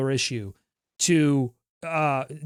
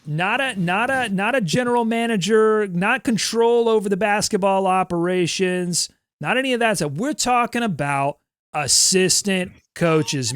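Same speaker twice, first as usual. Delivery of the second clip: lightly imitating Allen Iverson's rant, Boston vowels; ranting about assistant coaches, faint Boston accent; mild Boston accent; 5 semitones higher than usual. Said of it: "Are you a Boston Allen Iverson".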